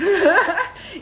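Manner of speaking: sudden loud, high laughing burst. Real, not acted. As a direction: crack up at herself. Laughing about her Hindi troubles.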